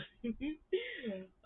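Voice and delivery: sudden high-pitched laugh. Real, not acted. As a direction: break into a high little laugh. Laughing mid-rant about production.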